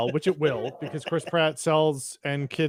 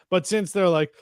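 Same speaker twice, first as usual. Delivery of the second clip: ordinary talk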